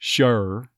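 Sure with an uh vowel, shur; Texas sure. Shown as a demonstration. An accent coach demoing Sure in Texas. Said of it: "say sure instead of sure".

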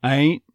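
"Ain't" as "ain", stopping on N; dropped T. Shown as a demonstration. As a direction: leave the T off a high "Ain't". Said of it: "The T very possibly getting dropped".

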